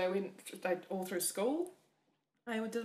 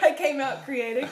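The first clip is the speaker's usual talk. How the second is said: laughing; 6 semitones higher, 13 dB louder than usual. Real, not acted. Laughing at own joke.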